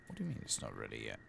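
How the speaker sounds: usual speaking voice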